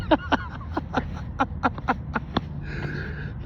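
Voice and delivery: airy unvoiced chuckle; breathy laugh. Real, not acted. Laughing at onlooker.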